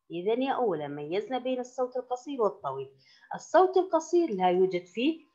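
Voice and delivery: ordinary delivery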